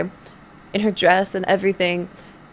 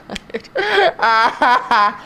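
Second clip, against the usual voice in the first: laughing; 3 semitones higher than usual. Real, not acted. Laughing at the awkward date.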